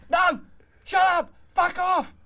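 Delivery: full high-pitched furious shout; screaming. Retold or imitated. Girlfriend yelling back at her coaching boyfriend, screaming. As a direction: scream it, each order a separate blast.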